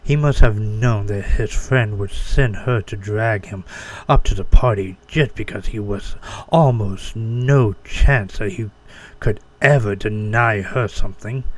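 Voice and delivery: ordinary delivery, nothing marked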